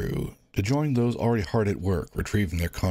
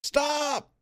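much higher, piercing cry; Elvin cries stop. Acted. Elvin stopping the fighting crowd, piercing cry. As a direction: cut through the noise. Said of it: "Elvin's voice cut through".

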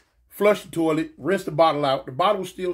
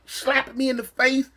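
ordinary talk

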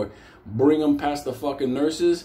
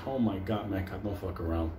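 hushed warning; 7 semitones lower, 9 dB quieter than usual. Retold or imitated. Warning friend, nervous aside.

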